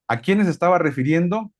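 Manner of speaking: ordinary talk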